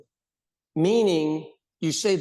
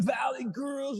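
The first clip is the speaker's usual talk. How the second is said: put-on valley girl call; 8 semitones higher, 3 dB quieter, slower than usual. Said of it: "they would go like".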